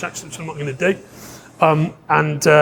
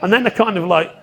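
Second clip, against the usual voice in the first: usual speaking voice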